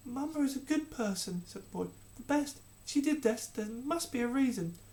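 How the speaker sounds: put-on high, insistent child; earnest boy. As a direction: prince defending his mother. Prince defends mother.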